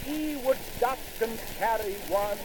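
ordinary delivery, nothing marked

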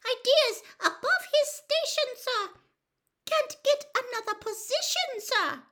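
very high, fretful elf squeak; Winky, sad. A narrator's reading. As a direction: squeak out the lament as a little elf. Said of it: "said Winky sadly".

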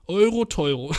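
loud, lightly voiced grumpy grandpa; a grandpa griping about prices, loud; grandpa catchphrase. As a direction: grumble the old saying again with gusto. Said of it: "hat er immer gesagt".

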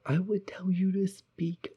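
whispered, breathy menace; a teasing whisper. Retold or imitated. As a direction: hush it out. Whispered quote.

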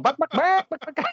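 very high mock chicken bawks; high, hammed-up chicken noises; chicken clucking. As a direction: bawk like a chicken rolling on the ground. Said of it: "like a chicken going".

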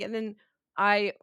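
normal talking voice

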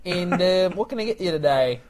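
put-on muddled English-Australian waiter accent; absurd British accent. Waiter greeting an IHOP customer, in a muddled British-Australian accent. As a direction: greet in a wandering, badly faked British accent. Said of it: "it was all over England and all over Australia".

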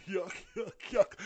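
hammed-up yokel laugh. Mocking the supervisor with a yokel laugh.

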